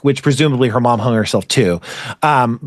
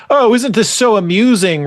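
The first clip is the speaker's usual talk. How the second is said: higher, hammed-up pinky-raised snob; mocking a pretentious character with pinky raised; snooty mock aristocrat; 7 semitones higher than usual. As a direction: play a smug aristocrat sipping tea. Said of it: "Raised pinky".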